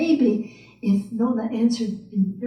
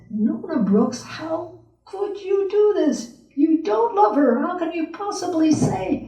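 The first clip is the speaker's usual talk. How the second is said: much higher, incredulous protest; scolding inner voice; 7 semitones higher than usual. Acted. Nona's inner voice scolding her, high incredulous protest. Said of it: "that other voice was saying".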